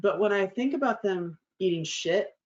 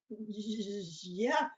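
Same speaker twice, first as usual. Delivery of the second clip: meek yeah; 2 semitones higher, 9 dB quieter than usual. Retold or imitated. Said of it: "I was like".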